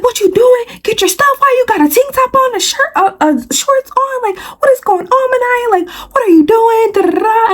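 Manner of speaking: high mock scolding at ordinary volume; piled-up scolding questions in a high voice; grandma yelling. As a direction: imitate a grandma going off, pitched far up. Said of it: "She just started screaming and yelling".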